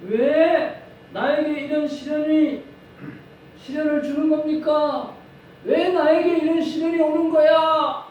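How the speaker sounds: building from a slow loud lament to shouted whys; anguished outcry. Retold or imitated. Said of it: "이렇게 소리를 지르죠".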